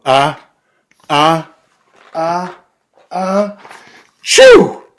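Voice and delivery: rising ah ah ah to a loud chew; building sneeze. Acted. Bear sneezes at the pepper, building to a loud sneeze. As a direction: wind up and explode the sneeze. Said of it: "He blows and he sneezes".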